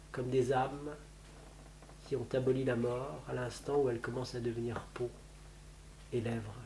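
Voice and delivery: soft, low, intimate storytelling; hushed narration. A narrator's reading. Narrator describes the pine by the sea, softly hushed. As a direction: drop almost to a murmur, as if confiding.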